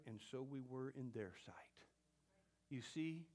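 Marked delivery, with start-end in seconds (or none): 0.0-1.9 hushed whisper; whispered scripture line. Real, not acted. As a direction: breathe it softly.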